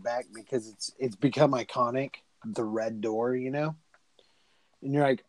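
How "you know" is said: ordinary talk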